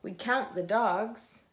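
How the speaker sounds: plain reading voice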